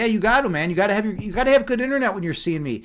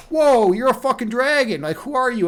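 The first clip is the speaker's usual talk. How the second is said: higher-pitched startled exclamation; mock astonishment; 6 semitones higher, 3 dB louder than usual. Construction boss to the dragon that swooped down, acted astonishment. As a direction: play a man stunned by what landed.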